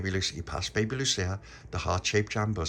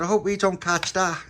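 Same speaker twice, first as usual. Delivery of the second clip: put-on worried child; 11 semitones higher, 7 dB louder than usual. Child worried about catching illness.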